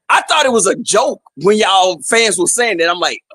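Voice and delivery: ordinary talk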